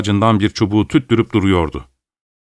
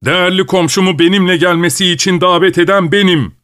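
shouting; 8 semitones higher than usual. A narrator's reading. Old father shouts at the landowner defending his neighbour. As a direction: fling the words back at him. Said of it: "diye bağırdı Pavel Martinoviç".

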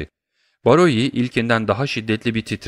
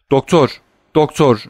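high, louder panicked call; alarmed raised voice; crying out; 5 semitones higher, 6 dB louder than usual. A narrator's reading. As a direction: call urgently for the doctor, twice. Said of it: "diye bağırdı".

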